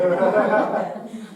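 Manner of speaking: a loud burst of laughter. Real, not acted. Student laughs after retelling her own puzzled question.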